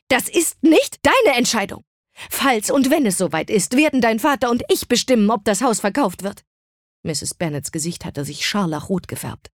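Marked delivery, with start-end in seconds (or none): 0.1-6.4 höher und lauter, erbost; Schreien. A narrator's reading. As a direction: schrei die Tochter an, das letzte Wort gehört dir. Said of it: "schrie ihre Mutter". (Mutter schreit Liz an der Fahrertür an)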